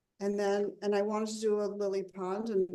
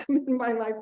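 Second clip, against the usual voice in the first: laughing through the words; amused chuckle mid-sentence; 3 semitones higher, 6 dB louder than usual. Real, not acted. Laughing at her own overstatement.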